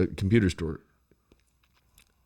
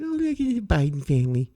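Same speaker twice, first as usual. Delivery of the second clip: higher, mock-gushing coo; mock gushing onlookers; 8 semitones higher than usual. Bystanders cooing over the family at the holiday, mock-gushing higher. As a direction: gush like starstruck bystanders.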